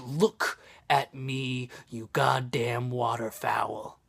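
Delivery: slow, deliberate acted menace; fed-up manager losing patience; stern warning. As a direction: stare the duck down with measured words. Manager stares down the persistent duck.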